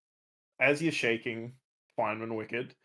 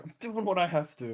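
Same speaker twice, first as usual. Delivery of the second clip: insistent; 5 semitones higher than usual. Acted. Player voices Feynman's answer to plea.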